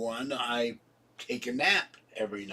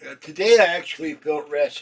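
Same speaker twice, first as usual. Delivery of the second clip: talking through a yawn; 3 semitones higher, 8 dB louder than usual. Real, not acted. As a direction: yawn while speaking. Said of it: "excuse me for yawning".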